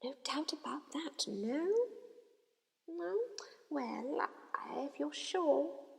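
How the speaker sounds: soft, slow, high whispering hat; the hat coaxes Harry toward Slytherin, whispered; small voice whispering. A narrator's reading. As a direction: tempt him in a hushed whisper. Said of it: "said the small voice".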